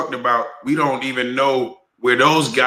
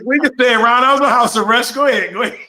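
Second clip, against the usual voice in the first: excited teasing; 9 semitones higher than usual. Real, not acted. Teasing interruption, laughing high.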